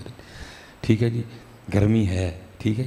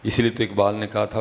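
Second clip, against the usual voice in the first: normal talking voice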